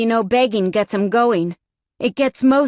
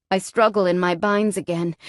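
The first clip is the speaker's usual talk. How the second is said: plain reading voice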